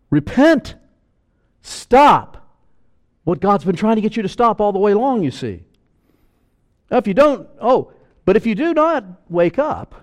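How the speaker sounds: loud, high, urgent pulpit cry; preaching at full force. Acted. Preacher urging the congregation to repent, full-force preaching. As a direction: thunder the warning at the congregation.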